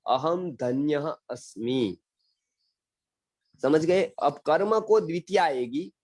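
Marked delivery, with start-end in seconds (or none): 0.1-2.0 slow Sanskrit model. Shown as a demonstration. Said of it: "जब मैं बताता हूँ".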